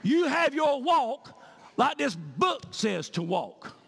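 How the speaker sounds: loud, high sermon at full force; fiery preaching. Acted. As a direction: preach it hard to the congregation. Back to preaching.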